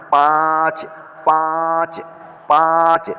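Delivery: पाँच said through the nose, the n hidden in a nasal vowel; nasal demo of पाँच. Shown as a demonstration. Teacher shows his pupils how to nasalize, slowly. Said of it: "तो नाक से बोल रहा हूँ".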